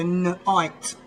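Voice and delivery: "night" split as nuh-ITE; spelling pronunciation. Shown as a demonstration. English speaker working through k-n-i-g-h-t, "night" as nuh-ITE. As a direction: break "night" after the n, long capital I. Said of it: "the I is pronounced as a capital".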